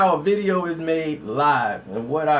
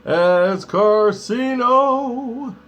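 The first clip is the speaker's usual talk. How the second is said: showman intro; much slower than usual. Pretend. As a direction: stretch the words out like a ring announcer. Hyped intro before making a video.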